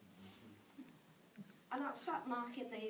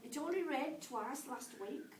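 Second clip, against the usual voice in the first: higher, louder recital of a letter; comic letter reading; 4 semitones higher, 9 dB louder than usual. Acted. Mum writes a silly letter to her son, comic letter reading. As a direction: read it out line by line, pausing for each punchline.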